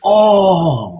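high, drawn-out "oh" of realization; long dawning-on-me exclamation; sudden realization. Retold or imitated. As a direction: act out the penny dropping. Acts out moment of realization.

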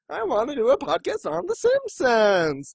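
high, put-on begging; mock pleading. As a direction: beg like a kid denied a treat, playfully. Mock pleading for Simpsons podcast.

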